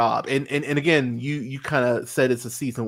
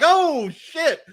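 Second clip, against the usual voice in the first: high, loud mock-shocked "oh shit"; 5 dB louder than usual. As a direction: gasp it out at the gore. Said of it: "I was like".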